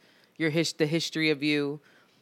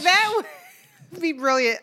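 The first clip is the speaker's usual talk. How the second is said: delighted; 10 semitones higher, 3 dB louder than usual. Retold or imitated. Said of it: "And I'm like".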